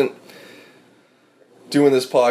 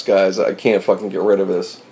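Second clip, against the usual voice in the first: ordinary talk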